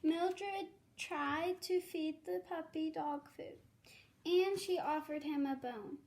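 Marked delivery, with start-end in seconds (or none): none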